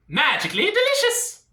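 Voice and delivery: high, lilting cartoon Irish; mock Irish accent. Comedian tells the ladies with a slogan, high mock Irish. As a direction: squeak it out in leprechaun Irish.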